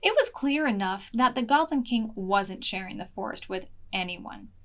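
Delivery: ordinary delivery, nothing marked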